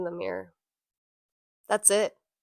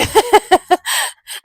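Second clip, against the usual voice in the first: loud laugh; 10 semitones higher, 9 dB louder than usual. Real, not acted. Laughing after cheer impression.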